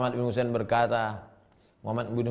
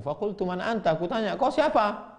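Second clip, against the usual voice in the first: startled question; 8 semitones higher than usual. Acted. Preacher acting a startled question.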